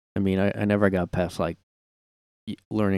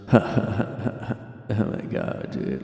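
stoned drawl; 3 dB quieter, much slower than usual. Retold or imitated. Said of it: "their eyes were barely awake".

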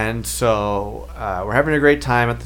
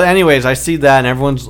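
normal talking voice

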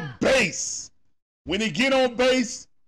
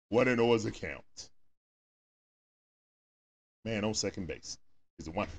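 ordinary talk, nothing marked

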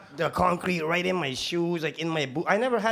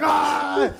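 higher, louder pained yell; retold yell; 9 semitones higher, 6 dB louder than usual. Rookie yelling with concrete in his eye, mock yell. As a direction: cry out in alarm. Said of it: "I'm just like".

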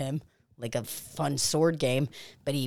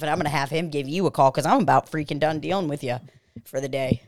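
normal talking voice

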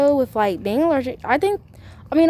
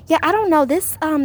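higher-pitched mock mama voice; imitating mama; 7 semitones higher, 5 dB louder than usual. Daughter mimicking her mama complaining about itchy fabric, imitating mama. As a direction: put on mama's hesitant complaint, pitched up.

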